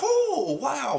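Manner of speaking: very high, drawn-out imitated wonder; Chopper. Chopper when they all meet up, high wowed voice. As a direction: coo it up high like a naive little character. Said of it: "He'll be like".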